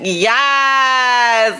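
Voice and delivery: hyped, stretched cheer; celebratory yes. Real, not acted. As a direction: hold the yes in exultation. Celebrating viewers showing up.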